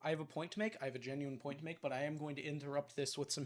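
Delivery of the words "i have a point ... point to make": usual speaking voice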